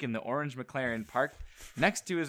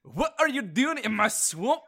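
high, louder Scottish ogre voice; attempted Scottish accent; 10 semitones higher, 5 dB louder than usual. Host taking his turn at Shrek's line, Scottish ogre accent. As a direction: have a go at a gruff Scottish ogre. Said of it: "I'll try".

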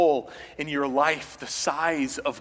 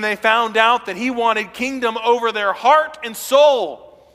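loud, rising sermon delivery; full-force preaching; 7 semitones higher, 6 dB louder than usual. Acted. Preacher to congregation, loud full-force preaching. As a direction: preach it out to the whole church.

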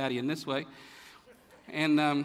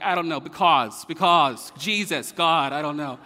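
loud, flustered mock giving-up; exasperated parent; 5 dB louder than usual. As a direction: play a parent who has run out of answers. Worn-out parent runs out of answers.

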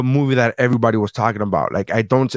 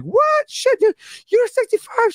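shrieking high disbelief; hammed-up screech of surprise, way up high; shocked old lady; slower than usual. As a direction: squeal like a stunned old woman.

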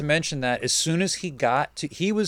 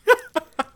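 a sudden high laugh, real; burst of high laughter; 4 dB louder than usual. Real, not acted. Laughing at the customer who hung up on her. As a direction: laugh out loud in disbelief.

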